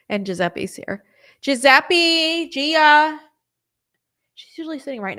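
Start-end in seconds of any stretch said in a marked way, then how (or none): 1.4-3.2 high, loud calls to the pets; calling the pets by name, loud and high; calling the dogs. Real, not acted. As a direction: summon the dogs from across the room. Calling both dogs over.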